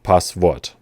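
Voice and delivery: Passwort said pahs-vort, with a German w like v; German pronunciation. Shown as a demonstration. Teacher shows how Germans say password, German pronunciation. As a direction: Passwort with German vowels and a final t. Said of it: "So you'd say".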